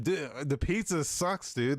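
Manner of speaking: ordinary talk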